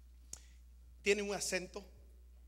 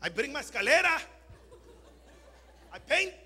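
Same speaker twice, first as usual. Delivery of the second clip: higher, lightly mocking eager English; 6 semitones higher, 5 dB louder than usual. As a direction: play a keen immigrant handyman. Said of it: "Fueron delante de los americanos". (imitando al trabajador entusiasta)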